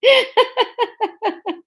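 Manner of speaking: high giggling fit; long, high, real laugh; helpless laugh. Real, not acted. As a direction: crack up laughing. Cracks up at own bad accent.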